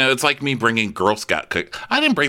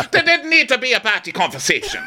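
mock dramatic complaint. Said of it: "she's like".

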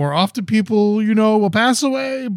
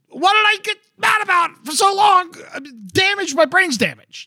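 mock self-scolding; 14 semitones higher than usual. Mocking his own made-up fight, high hammed-up yell.

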